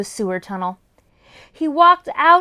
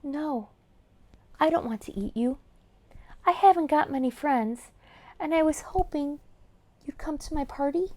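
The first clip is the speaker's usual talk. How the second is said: much softer, shy and small; 8 dB quieter than usual. Acted. Lonely Trollger shyly inviting the boy, much softer. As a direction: say it bashfully, eyes down. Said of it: "said in a shy voice".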